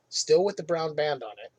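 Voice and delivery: ordinary delivery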